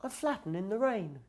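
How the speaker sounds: very high, timid little creature; small monster. Acted. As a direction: speak as a tiny shy monster.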